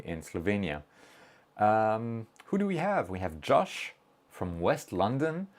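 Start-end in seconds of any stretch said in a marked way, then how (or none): none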